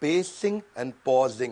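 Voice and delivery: very slow, low "Pacing and pausing"; timed pauses. Shown as a demonstration. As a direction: break "Pacing and pausing" up with deliberate gaps. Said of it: "सही समय पर चुप रहना".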